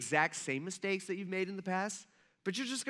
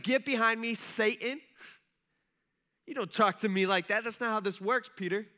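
raised, firm acted reprimand; mock stern rebuke; 3 semitones higher, 3 dB louder than usual. Jesus rebuking Peter as Satan, mock stern. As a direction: put him in his place, playfully stern.

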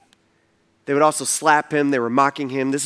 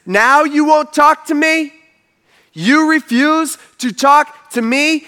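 high, loud, slow disbelief; drawn-out indignant challenge; 10 semitones higher, 5 dB louder, slower than usual. Retold or imitated. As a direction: demand it, affronted, stretching the words. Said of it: "the Pilate looks at him, he says".

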